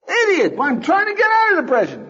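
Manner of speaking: high, loud furious yell; angry yell. Retold or imitated. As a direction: yell back at the friend, fed up. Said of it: "You yell at him".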